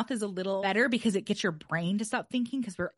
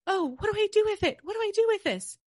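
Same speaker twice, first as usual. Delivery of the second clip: much higher, fast, flustered squeak; confused brain voice; 12 semitones higher, 4 dB louder, faster than usual. Pretend. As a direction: play the brain panicking, what do I do. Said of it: "and then it says".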